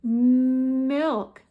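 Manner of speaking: milk with a long hummed "mmm" start; drawn-out M. Shown as a demonstration. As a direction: stretch the M at the front of "Milk". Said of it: "makes the M sound?".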